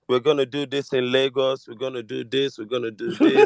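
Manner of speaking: tame, quiet campaign promise with a laugh creeping in; mock Gbadebo soft voice. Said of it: "na this in voice".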